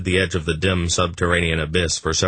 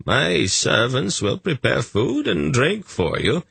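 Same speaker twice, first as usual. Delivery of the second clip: put-on kindly old man; 8 semitones higher, slower than usual. Gorim voiced high slow.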